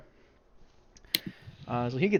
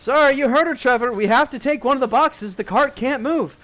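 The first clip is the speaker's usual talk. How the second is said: high, loud, apologetic bandit voice; sheepish bandit; 11 semitones higher, 10 dB louder than usual. Acted. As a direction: shrug it off to Trevor, loud and high. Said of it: "the guy in the back goes".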